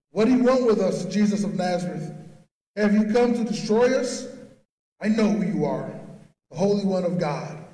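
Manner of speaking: evil spirit crying out, as read from scripture. A narrator's reading. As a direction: call it out loudly, then settle into declaration. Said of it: "cried out".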